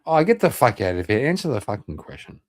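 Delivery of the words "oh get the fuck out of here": ordinary delivery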